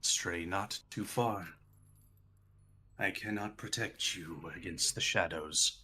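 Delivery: low, soft, measured warning; hushed cultist voice; eerie cultist figure. Acted. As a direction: warn them quietly as an ominous guide. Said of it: "he goes".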